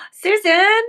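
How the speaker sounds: gasp, then a high, loud cry; excited exclamation. Real, not acted. Host seeing her partner's finished straps, excited exclamation. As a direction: burst out with delighted amazement.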